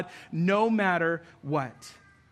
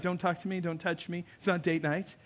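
cold mock brush-off; 3 semitones lower, 7 dB quieter than usual. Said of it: "be like".

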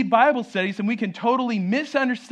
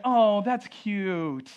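drawn-out mock "aww" voice; mock cooing; much slower than usual. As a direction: imitate someone cooing over a cat, slowly. Imitating listeners cooing at cat.

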